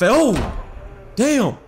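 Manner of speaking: loud, high burst of alarm; real startled yelp. Real, not acted. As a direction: yelp sharply as if caught off guard. Lights suddenly go out.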